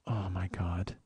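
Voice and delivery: low, soft, sinking disbelief; quiet dismay. Retold or imitated. Man reading her puzzled text, quietly dismayed. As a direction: let it out small and low, dismayed.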